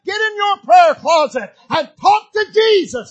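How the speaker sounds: loud, rising preacher's cry building to a shout; full-force preaching. Acted. Preacher rousing the congregation with a loud, rising sermon cry.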